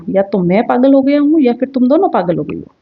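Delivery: usual speaking voice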